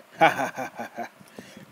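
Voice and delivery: loud, high cackle; old man laughing at the boy, loud cackle; outburst of laughter. Acted. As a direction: burst into a mocking cackle. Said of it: "an outburst of laughter".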